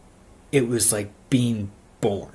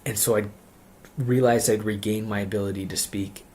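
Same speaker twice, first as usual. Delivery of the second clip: ordinary talk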